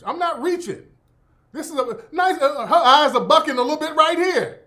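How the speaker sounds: high, loud indignant insistence; loud, defensive protest. Real, not acted. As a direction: push back hard at the accusation of reaching. Defending himself against accusation of reaching.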